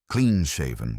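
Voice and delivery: ordinary delivery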